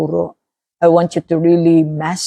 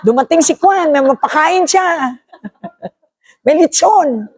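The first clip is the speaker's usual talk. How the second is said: high, giggly tempter coaxing you to skip; a sly devil whispering temptations with a laugh; tempting demon voice; 10 semitones higher than usual. Acted. Preacher voicing the demon tempting a believer, high and giggly. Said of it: "yung boses naman ng demonyo".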